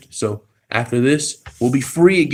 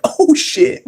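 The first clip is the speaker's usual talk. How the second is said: high, amazed exclamation; amazed reaction; 3 dB louder than usual. Retold or imitated. Retelling his reaction to McGregor, amazed. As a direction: blurt it out in disbelief. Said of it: "I was laughing out loud".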